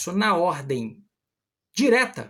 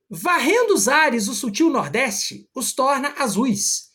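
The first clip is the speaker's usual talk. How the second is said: lifted, slow recital of the verse; teacher reading poetry aloud to the class; poem recital; 5 semitones higher, 4 dB louder, slower than usual. Acted. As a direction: declaim the line with feeling. Reads the sonnet aloud.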